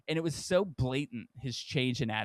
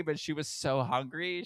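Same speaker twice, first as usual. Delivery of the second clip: usual speaking voice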